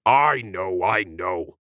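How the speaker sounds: alien voice, unlike narrator; hurried, wet alien reply; squirted back. A narrator's reading. Alien answering the dog, squirting back. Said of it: "the oversized cephalopod squirted back".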